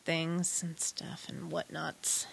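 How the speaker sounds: barely audible evasive whisper. Real, not acted. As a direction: breathe it out, dodging the question. Evasive mumbled answer.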